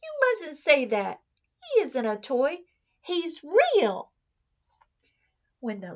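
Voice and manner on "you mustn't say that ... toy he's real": put-on high, loud child protesting; boy correcting his nanny, high and loud; boy insisting. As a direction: defend the bunny with a child's fervour. Boy insists bunny is real.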